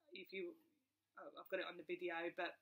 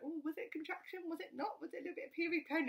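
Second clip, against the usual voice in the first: higher, chattering, curious tone; mock gossiping patients; 8 semitones higher, faster than usual. Mother mimics nosy ward patients at dinner, high and chattering. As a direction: lightly mimic nosy neighbours wondering aloud. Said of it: "people sitting there having tea".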